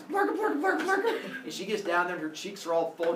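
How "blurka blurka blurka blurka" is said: high, laughing blurka blurka; stuffing noises. Acted. Parents shoving coins into the girl's mouth, laughing gibberish. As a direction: giggle the coin-shoving noises.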